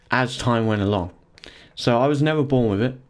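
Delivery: ordinary talk, nothing marked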